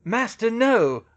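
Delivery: slow, high, loud plea; drawn-out alarmed shout; shouted protest. A narrator's reading. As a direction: shout to stop your master. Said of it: "Rookwood shouted".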